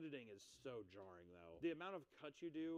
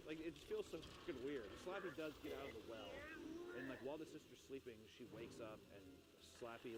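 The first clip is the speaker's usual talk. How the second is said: usual speaking voice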